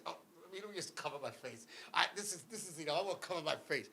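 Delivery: very soft, low, laughing mumble; hushed mock hiding. Buried brother hiding his face from onlookers, hushed. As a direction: play someone trying to disappear, barely audible.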